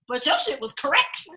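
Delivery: ordinary talk, nothing marked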